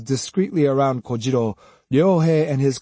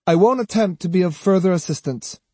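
higher, airy character voice; Kojiro, blithe; 6 semitones higher than usual. A narrator's reading. Swordsman withdrawing his help, blithely. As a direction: withdraw the offer lightly.

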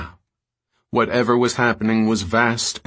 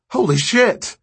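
high, loud horrified outburst; shocked exclamation; 13 semitones higher, 5 dB louder than usual. Acted. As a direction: blurt it out in shock. Caleb sees the bodies.

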